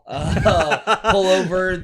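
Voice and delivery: laughing through the words. Real, not acted. Co-worker laughing while praising the card. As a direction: chuckle while you say it.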